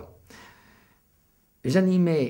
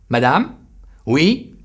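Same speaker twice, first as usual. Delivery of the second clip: loud, firm call that carries across the room; commanding call; 6 semitones higher, 10 dB louder, slower than usual. Retold or imitated. Retelling how the waitress was finally summoned, loud and firm. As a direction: claim the room with your voice alone. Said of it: "Je me contente de lui dire".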